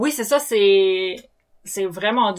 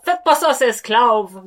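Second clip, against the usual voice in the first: put-on her Acadian accent; 2 semitones higher, 6 dB louder, slower than usual. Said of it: "J'ai mon accent".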